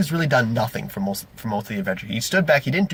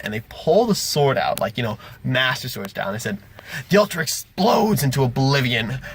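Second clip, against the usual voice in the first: normal talking voice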